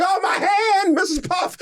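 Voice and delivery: high whiny mock cry; imitating SpongeBob. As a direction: whine it up high with a laugh. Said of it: "he's like".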